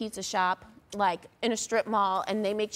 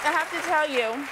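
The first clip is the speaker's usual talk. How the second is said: talking with mouth full; words round a bite of cheesesteak; chewing steak; 4 semitones higher than usual. Real, not acted. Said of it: "excuse me for talking with my mouth full".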